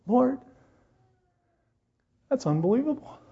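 high, stunned cry of wonder; amazed. Retold or imitated. Preacher retelling his amazement at the check, high and stunned. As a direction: gasp it out in disbelief. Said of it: "I was like".

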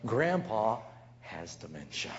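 low, soft, slow comforting; gentle reassurance. Retold or imitated. Host calming guest about Grandpa, soft and gentle. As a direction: soothe the upset guest.

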